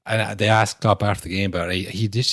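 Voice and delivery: ordinary talk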